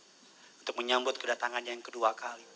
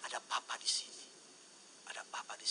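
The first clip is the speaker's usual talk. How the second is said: put-on whispered comfort to a frightened child; soothing whisper; 12 dB quieter than usual. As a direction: hush them gently, barely voiced. Father comforting fallen child.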